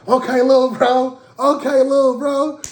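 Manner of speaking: high, loud celebrating tease; hyped friend praise. Retold or imitated. Homies go crazy as he leaves with her, high hype. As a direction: cheer him on like a proud big brother.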